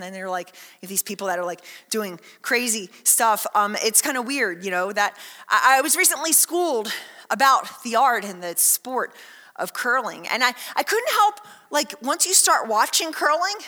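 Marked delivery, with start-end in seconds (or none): none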